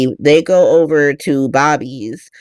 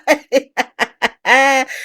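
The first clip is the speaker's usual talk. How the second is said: a real burst of high laughter; 8 semitones higher than usual. Real, not acted. Laughing at the soap plot.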